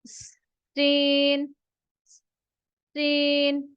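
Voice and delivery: slow model "सीन" for learners; letter demo. Shown as a demonstration. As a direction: stretch the s of "सीन". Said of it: "वो है हमारे पास सीन".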